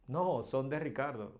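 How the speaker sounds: ordinary talk, nothing marked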